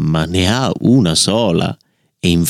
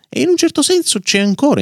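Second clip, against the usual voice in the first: high playful; faster than usual. Shown as a demonstration. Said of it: "closing echo of opening".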